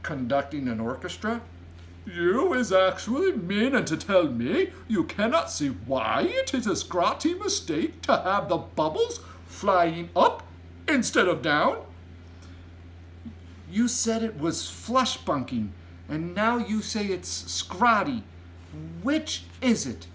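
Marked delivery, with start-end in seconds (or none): 2.1-11.9 loud, high, lecturing giant; indignant giant voice, swooping pitch; giant crying out. A narrator's reading. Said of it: "cried the B F G". 13.7-20.1 put-on higher little girl's voice; measured, high-pitched query; polite. A narrator's reading. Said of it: "Sophie asked politely".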